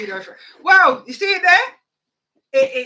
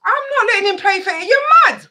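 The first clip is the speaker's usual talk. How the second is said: much higher and louder, outraged outburst; 8 semitones higher, 8 dB louder than usual. Real, not acted. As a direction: blow up at the very idea. Heated rant about son playing.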